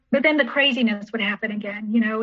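normal talking voice

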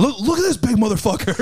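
high-pitched mock sergeant call-out; single someone out like a sergeant; drill sergeant. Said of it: "drill sergeant looking dude up front".

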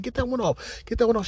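squeaky high urgent plea; high mock voice. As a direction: keep it up in a squeaky falsetto. Teller repeats his own high quote.

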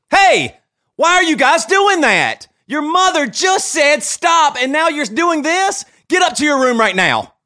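put-on father yelling kids back to their room; barely controlled anger. Shown as a demonstration. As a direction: snap at kids who went too far. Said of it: "let me hear what it sounds like".

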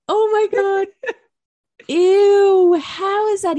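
scared shout. Real, not acted. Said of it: "screaming into the mic".

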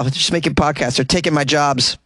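usual speaking voice

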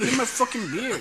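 high, loud mock outrage with laughter; mock angry demand. Retelling a friend's beer quarrel, high laughing mock anger. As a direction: imitate a riled drinker through a laugh.

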